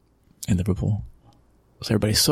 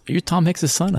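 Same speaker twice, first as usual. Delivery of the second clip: surprised question; 9 semitones higher than usual. Retold or imitated. A local asking if he's the owner's son, high and surprised. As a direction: pitch jumps high in mock astonishment. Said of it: "Even somebody said this".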